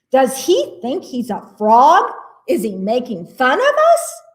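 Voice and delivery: storybook indignation; indignant questions. A narrator's reading. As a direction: ask it as if mildly insulted. Frogs indignant at the pig.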